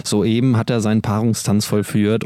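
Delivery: ordinary delivery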